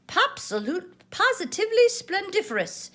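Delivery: high, loud, showy praise; purring praise. A narrator's reading. Tigger admiring the finished painted heart. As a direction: relish the big word. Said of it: "Tigger purred".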